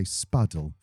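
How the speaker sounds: Southern English spuddle, short u as in cup; Southern English accent. Shown as a demonstration. Co-host trying the Southern English way of saying spuddle. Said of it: "if we were Southern".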